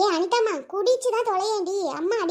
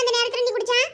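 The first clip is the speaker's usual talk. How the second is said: ordinary talk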